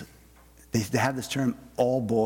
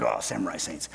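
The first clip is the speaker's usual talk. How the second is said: mock guy excitement; 6 semitones lower, 4 dB quieter than usual. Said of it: "I'm like".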